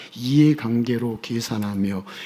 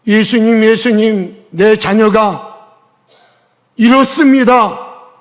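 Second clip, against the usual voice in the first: louder and higher, slow pleading cry; shouted prayer; 8 semitones higher, 12 dB louder, slower than usual. Shown as a demonstration. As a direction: cry out to Jesus for your child. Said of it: "소리 질러 보세요". (자녀 때문에 예수님께 크게 부르짖듯)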